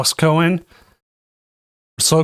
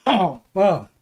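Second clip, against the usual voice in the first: coughing and spluttering; choking on water, coughing; 5 semitones higher than usual. Real, not acted. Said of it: "Water went down the wrong windpipe".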